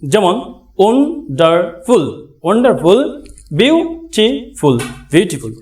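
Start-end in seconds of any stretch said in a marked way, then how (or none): 0.8-2.3 slow "wonderful" broken into three syllables; syllable split. Shown as a demonstration. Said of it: "try syllable বলে". 3.6-4.8 slow "beautiful" broken into three syllables; syllable split. Shown as a demonstration. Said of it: "try syllable বলে".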